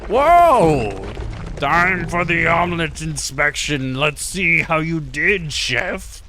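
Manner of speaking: loud, high, booming entrance of a mock school principal; pompous principal. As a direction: announce the inspection grandly. Principal arrives for omelet inspection.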